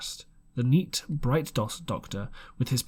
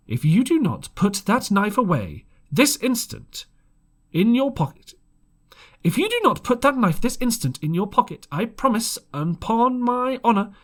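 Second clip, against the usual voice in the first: higher, louder, steady threat; high calm warning; 9 semitones higher, 6 dB louder than usual. A narrator's reading. Doctor threatens the captain with hanging, high and steady. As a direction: keep it high so all the room hears. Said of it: "in the same tone and voice, rather high".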